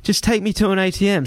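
high, urgent offer of money; plead, voice straining; desperate pleading. Retold or imitated. Man begging the driver to take cash, desperate. Bargaining for their lives.

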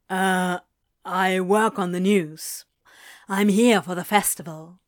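low, hesitant reply; reluctant journalist. A narrator's reading. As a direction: answer someone you can't place. Awkward reply to stranger.

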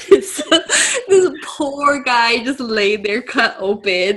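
slow words squeezed through real laughter; laughing. Real, not acted. Co-host pitying the patient cut open, laughing. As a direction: struggle to speak while cracking up. Cracking up about stolen patient.

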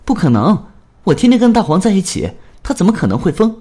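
put-on high, loud, fast boyish shout; indignant shout, racing; shouting in protest. A narrator's reading. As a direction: yell it back at the captain, refusing. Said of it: "站起来冲着吴大昌喊".

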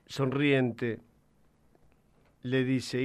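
usual speaking voice

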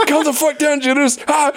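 high, exasperated radio call; mock radio yell. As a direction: bark at a panicking soldier in jest. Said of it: "it'd be like".

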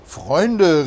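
loud call to the thieves. A narrator's reading. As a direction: call out to them loudly. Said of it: "rief er ihnen zu". (lauter Zuruf)

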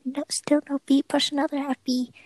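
frantic TV doctor shouting orders; hammed-up medical drama callouts; trauma bay callouts. Acting a TV hospital scene.

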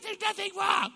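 high, loud shrieked denial; a hammed-up high yell; mock shout. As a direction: shriek it like the upset wife. Wife's denial escalates.

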